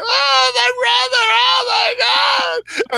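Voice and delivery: a player in the play hamming up his grief for Loki; whiny crying. Retold or imitated. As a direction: wail over the brother like a ham actor. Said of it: "acting like a total whiny little bitch".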